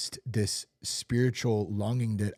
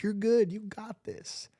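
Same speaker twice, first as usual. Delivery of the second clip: high, sneering inner voice egging him on; the lying voice, pitched up and teasing; mocking tempter; 11 semitones higher, slower than usual. Voice in his head mocking him, high and sneering. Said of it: "Enemy starts creeping in".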